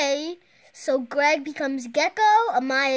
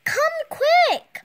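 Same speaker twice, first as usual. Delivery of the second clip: loud high urgent shout; 8 dB louder than usual. Acted. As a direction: shout for them to hurry. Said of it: "Greg shouts".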